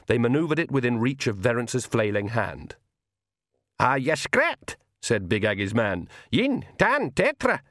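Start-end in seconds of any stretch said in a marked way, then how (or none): 3.8-4.8 lightly Scottish pixie voice; a gruff little Feegle, a bit worried. A narrator's reading. Feegle asks Verence. 6.3-7.6 high, sharp Scottish countdown; high Feegle count. A narrator's reading.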